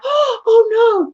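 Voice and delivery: high, drawn-out mock dismay; hammed-up worried reaction; overdramatic alarm. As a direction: gasp as if a child fell. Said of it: "if a child falls and you react".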